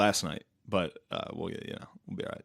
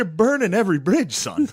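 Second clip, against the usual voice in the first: higher, louder mock scolding father; scolding dad; 8 semitones higher, 4 dB louder than usual. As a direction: lecture your son, hammed-up.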